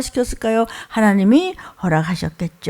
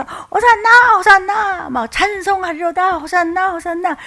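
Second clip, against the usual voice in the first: excited crowd cheering; 9 semitones higher than usual. Retold or imitated. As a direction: cheer like a crowd welcoming a king. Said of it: "막 신나서 하는 거예요". (신나서 외치는 군중 목소리)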